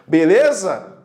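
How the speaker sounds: ordinary talk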